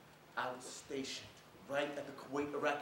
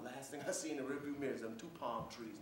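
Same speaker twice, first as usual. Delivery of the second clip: ordinary talk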